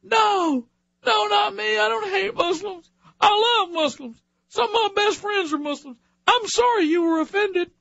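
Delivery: high, mock pleading voice; whiny, groveling apology; mocking whiny apologizer. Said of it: "And you're supposed to go".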